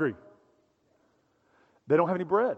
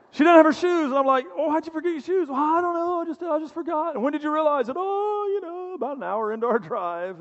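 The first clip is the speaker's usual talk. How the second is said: lightly voiced young girl, pitched up; high niece voice; 8 semitones higher than usual. Uncle questions his niece about her shoes, lightly voiced high. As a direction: play the forgetful niece, high and sheepish.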